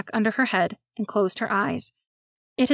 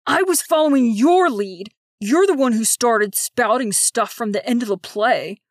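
angry; 5 dB louder than usual. Acted. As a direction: snap back in anger. Jordan rounds on Fawn.

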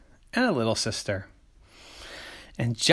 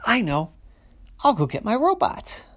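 eager idea; 10 semitones higher, 4 dB louder than usual. Acted. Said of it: "Big sister said".

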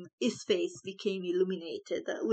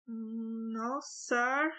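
small soft then higher squeak; a timid squeaked reply; 3 semitones higher, 4 dB quieter than usual. A narrator's reading. Maid answering her master timidly, squeaked. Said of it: "squeaked Sarah".

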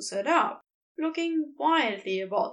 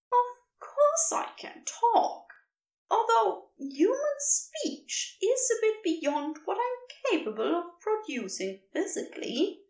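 unimpressed; 8 semitones higher than usual. A narrator's reading. Creature answering Crowley's shock, unimpressed and higher. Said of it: "deeply unimpressed look".

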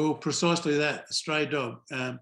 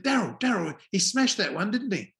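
raised, high-pitched mock call; imitated calling out; 6 semitones higher, 3 dB louder than usual. Bowler calling out to the umpire, imitated high call. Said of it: "Kevin Peterson called out to me".

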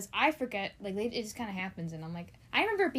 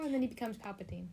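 normal talking voice

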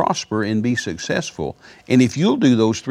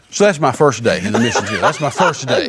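talking through a real laugh; amused laugh spilling over the line; 3 semitones higher than usual. Real, not acted. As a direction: chuckle the words out as you say them. Laughing at own story.

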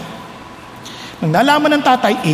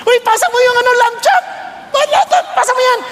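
high, offhand mealtime chatter; high-pitched mock nonchalance; brothers casually eating; 11 semitones higher than usual. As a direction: call for a dish, ignoring the screams. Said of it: "sila naman nagkakainan".

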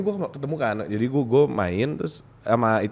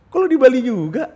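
mock surprised greeting; 12 semitones higher, 7 dB louder than usual. Retells greeting her in club.

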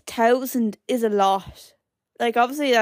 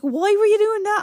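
high, louder retold question; incredulous mum; 11 semitones higher, 5 dB louder than usual. Mum questions daughter about crying at telly, incredulous. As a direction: imitate a baffled mammy demanding an explanation.

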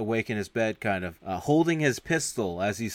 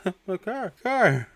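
high-pitched imitated lament, half laughing; mock dismay; 10 semitones higher, 4 dB louder than usual. Owner seeing his trashed car, mock dismay. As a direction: wail it in comic disbelief.

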